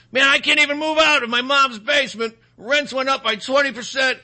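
mock whiny renter. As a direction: whine about the basement like a sulky kid. Mocking a Biden voter.

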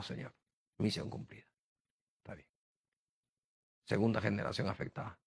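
softly murmured, half to himself; a quiet grateful word to God; hushed prayer. Retold or imitated. Pastor quietly telling the Lord his mission is done, hushed.

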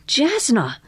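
high cry of recognition; lifted, delighted recognition; exclamation. A narrator's reading. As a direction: exclaim the name in surprise. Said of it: "exclaimed Missus Polifax".

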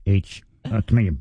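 ordinary delivery

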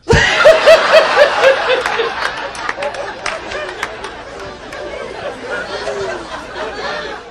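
breathy wheezing laugh. Real, not acted. Comic laughs at own joke.

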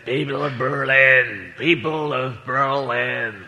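slow, low Boston drawl as Kennedy; lightly voiced Kennedy addressing the Berlin crowd; Kennedy impression. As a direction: lay on a slow Kennedy accent. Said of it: "and he said".

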